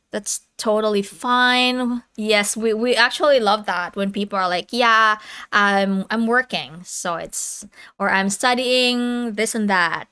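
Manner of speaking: normal talking voice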